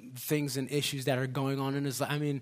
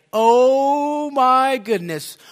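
loud, high, drawn-out cry of astonishment; freaked-out amazement; 10 semitones higher, 13 dB louder, much slower than usual. Retold or imitated. Preacher voicing the man as his hand starts moving, freaked out. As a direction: belt it out in stunned disbelief, stretching the words. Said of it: "he's just freaked out".

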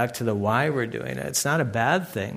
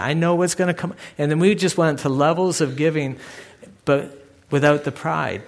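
normal talking voice

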